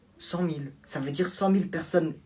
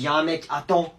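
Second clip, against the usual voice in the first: normal talking voice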